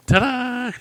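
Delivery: high, loud ta-da; mock triumphant reveal. Host caps the ping pong ball story, mock triumphant. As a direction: sing it out like a magician's flourish.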